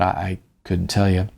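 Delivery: ordinary delivery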